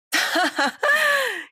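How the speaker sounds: high, drawn-out deliberate cackle; exaggerated fake laugh. A narrator's reading. Bride's jilted friend making an entrance, exaggerated laugh. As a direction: laugh on purpose, big and overdone. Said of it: "일부러 과장된 웃음소리를 내며".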